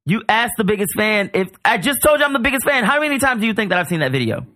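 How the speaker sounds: high, mock-offended superfan; indignant. Real, not acted. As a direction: act insulted by the question.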